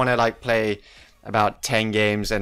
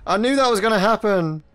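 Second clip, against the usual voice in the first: exasperated outburst; 10 semitones higher, 5 dB louder than usual. Real, not acted. Just died, exasperated.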